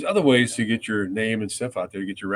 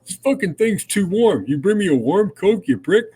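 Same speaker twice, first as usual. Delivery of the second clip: mock angry complaint; 8 semitones higher, 5 dB louder than usual. [name] pretends to scold the guy with warm Coke, mock angry shout. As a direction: bark it like an outraged star faking fury. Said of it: "he's going".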